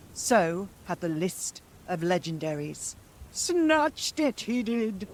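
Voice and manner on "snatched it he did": high wailing crone voice; high hag wail. Acted. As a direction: wail it out in despair. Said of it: "she wailed".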